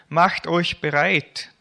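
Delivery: laut und langsam ausgerufen; rufend. Acted. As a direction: ruf es der Menge auf der Straße zu. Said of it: "Johannes rief ihnen zu". (Johannes ruft die Vorbeiziehenden laut auf)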